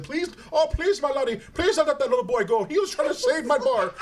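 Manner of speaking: high-pitched mock Irish plea; Irish owner pleading. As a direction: beg like an Irish bar owner. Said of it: "the owner came out and said".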